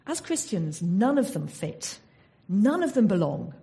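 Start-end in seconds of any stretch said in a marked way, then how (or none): none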